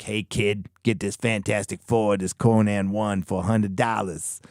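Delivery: put-on comic dealer's sales pitch; slowing into a hustling shop owner's voice; old-school store owner. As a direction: play an old-time shopkeeper pitching a kid. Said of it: "the O G store owners who are like".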